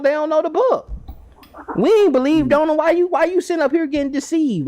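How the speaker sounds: high, mocking street voice; indignant Pharisees. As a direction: lecture the crowd like scornful Pharisees. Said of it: "The Pharisees asking that".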